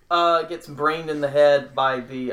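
usual speaking voice

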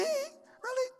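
very high, soft "Really?"; mock disbelief; incredulous God. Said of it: "He said".

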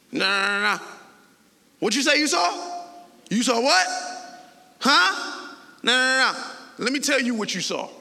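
loud, high mocking dismissal; defiant denial. Pharisees brush off the guards' report, loud and scoffing. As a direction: wave the soldiers off with loud denial. Voiced Pharisees denying testimony.

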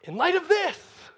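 high, loud, drawn-out presentation; triumphant Pharisee. Acted. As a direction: brandish the evidence in a high, slow cry. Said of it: "he pulls out the tax collector's resume".

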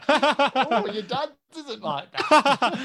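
laughing loudly through the words, stretched slowly; cracking up mid-sentence. Real, not acted. Laughing at partner's joke.

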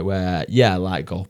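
ordinary talk, nothing marked

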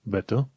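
"better" ending in "uh", R dropped; final R as schwa. Shown as a demonstration. Teacher recapping British "better", R turned to uh. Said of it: "R-ul de la final îl pronunți un fel de ă".

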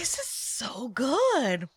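slow, drawn-out savoring; savoring delicious food. Real, not acted. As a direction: relish every word after a sip. Tasting the mystery beer.

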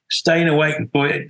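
normal talking voice

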